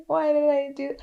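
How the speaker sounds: high, mildly hammed-up wail; retold sobbing. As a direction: imitate wailing in regret. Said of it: "I was sobbing".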